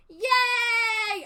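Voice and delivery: high, loud celebratory yay; mock cheer. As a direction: cheer like a crowd gone mad. Town cheers after heart carved out.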